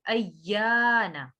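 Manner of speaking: अय्याना with doubled y and long held "yaa"; stretched yaa. Shown as a demonstration. Teacher showing the stretch, अय्याना with long "yaa". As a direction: draw out the middle of अय्याना slowly. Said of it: "या को खींचेंगे".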